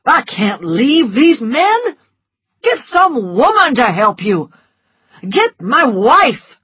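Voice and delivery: slow, loud furious refusal; angry doctor. A narrator's reading. Doctor refusing Scarlett, slow and furious. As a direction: bark it out, outraged. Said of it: "thundered the doctor".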